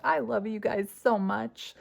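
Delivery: a drawn-out, gushy echo of what she told viewers; replaying her own sweet sign-off. Mother replaying her sign-off for viewers, slow and sing-song. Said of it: "after I said".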